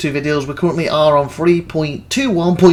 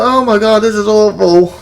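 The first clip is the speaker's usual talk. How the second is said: higher, mildly mock-horrified complaint; retold dismay; 6 semitones higher, 4 dB louder than usual. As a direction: lament it with a touch of despair. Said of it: "I was like".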